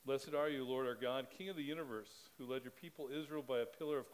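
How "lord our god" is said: usual speaking voice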